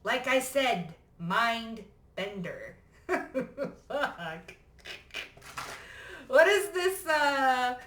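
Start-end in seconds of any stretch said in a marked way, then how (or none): 3.1-3.8 giggle. Real, not acted. Laughing at herself. 6.3-7.8 high, loud startled question; high, loud incredulous gasp of a question; surprised. Real, not acted. Surprised at card.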